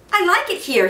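put-on brighter boyish voice; boy's announcement. A narrator's reading. Boy announcing his new room, eager and high. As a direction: announce it proudly like a child. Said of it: "he announced".

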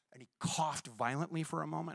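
ordinary delivery

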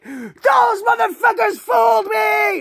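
high, slow, shouted outburst; furious shout. Retold or imitated. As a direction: scream it like she was tricked. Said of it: "she's like".

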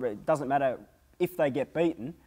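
normal talking voice

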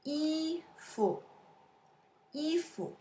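soft 衣 held high and level, 服 short and dropped; tone drill. Shown as a demonstration. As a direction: hold 衣, let 服 fall away. Teacher demonstrates word tones.

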